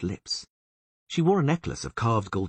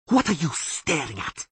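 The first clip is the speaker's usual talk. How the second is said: much higher, threatening hiss; elf hiss; 10 semitones higher than usual. A narrator's reading. Taruviel hissing at the staring Witcher, higher. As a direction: hiss at him. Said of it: "hissed the elf".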